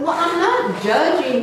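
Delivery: mock-indignant protest to God; indignant denial. Arguing with God.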